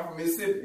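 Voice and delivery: low, quiet "Mississippi", squeezed short; local pronunciation. Shown as a demonstration. Southerner echoing his friend, low short local "Mississippi". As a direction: swallow the middle of "Mississippi", like "Missippi". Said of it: "they say it the right way".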